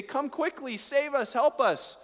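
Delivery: high, frantic mock plea for rescue; imitating the panicked Gibeonites, mock dramatic; overly dramatic plea. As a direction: ham up a desperate cry for help. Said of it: "they're overly dramatic".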